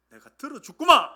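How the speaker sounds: Gyeongsang-style promise, loud on the ending; Gyeongsang dialect. Acted. Preacher voicing God's promise to answer prayer, Gyeongsang dialect. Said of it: "경상도식으로 하면".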